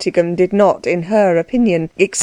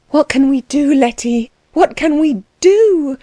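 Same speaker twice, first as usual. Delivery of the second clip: anxious cry; 6 semitones higher than usual. A narrator's reading. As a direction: cry out as if frightened by the fire. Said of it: "cried Anna".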